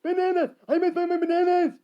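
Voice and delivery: high, loud, frantic monkey shriek; yelling monkey. Acted. Monkey hunting lost bananas, high ferocious yelling.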